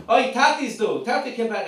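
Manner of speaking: high, loud calling-out yell. Shown as a demonstration. Kid escaping a locked room, loud yell. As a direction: yell it out to raise the alarm. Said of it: "and just yell loud".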